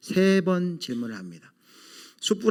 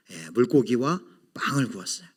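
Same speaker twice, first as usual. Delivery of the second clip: ordinary delivery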